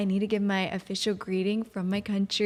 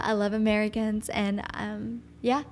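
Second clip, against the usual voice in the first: normal talking voice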